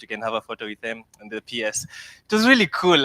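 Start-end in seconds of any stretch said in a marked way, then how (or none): 2.2-3.0 high, loud burst of delight; excited. Real, not acted. Recalling the award ceremony with students, excited and loud. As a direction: let the joy spill out, pitched up.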